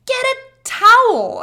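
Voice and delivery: high, loud and slow command; frantic shouted request; urgent order. Retold or imitated. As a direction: bark it out in a hurry.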